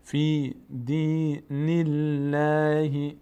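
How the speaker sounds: الله with a thin l after kasra, slow chant; slow recitation, thin lam in الله; light Allah. Shown as a demonstration. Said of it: "لفظ جلالی الله ... پر نمیشه".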